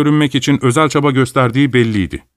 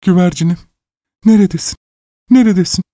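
breathless with joy; 9 semitones higher than usual. A narrator's reading. Overjoyed father calling breathlessly for his found daughter. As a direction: gasp the words out in happy relief. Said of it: "mutluluktan soluk soluğa kalmıştı".

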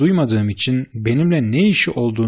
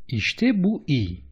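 higher, drawn-out approval; pleased, slow and raised in pitch; eager interjection; 5 semitones higher, much slower than usual. A narrator's reading. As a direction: jump in keenly, stretching the words. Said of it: "diye atıldı".

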